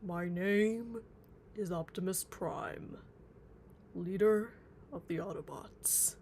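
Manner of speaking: slow, booming robot leader voice with a loud swell on leader; Optimus Prime impression. Streamer to chat, doing Optimus Prime for a request, slow and booming. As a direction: announce yourself like a noble robot commander. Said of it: "my best impression is".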